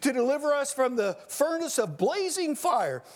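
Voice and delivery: high, ringing preacher's read of Daniel; fervent scripture reading. Acted. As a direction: proclaim the text as if from the pulpit.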